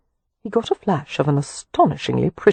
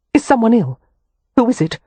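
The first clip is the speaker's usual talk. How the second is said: higher, urgent alarm; 7 semitones higher, 4 dB louder than usual. Acted. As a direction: ask in rising panic. Amelia alarmed.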